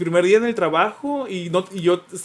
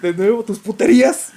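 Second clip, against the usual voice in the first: high, laughing mock accusation; 1 mock jealous partner; 11 semitones higher, 3 dB louder than usual. S1 voicing roommate André catching his cheating, mock outrage. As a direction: play the jealous boyfriend catching him, laughing.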